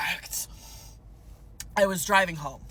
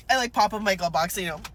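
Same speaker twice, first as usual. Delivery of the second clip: ordinary talk, nothing marked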